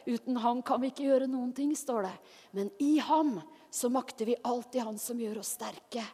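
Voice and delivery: ordinary talk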